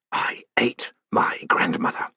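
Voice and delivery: slow, whispered low growl; growling creature. A narrator's reading. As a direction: rasp it like a monster. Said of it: "growled a voice".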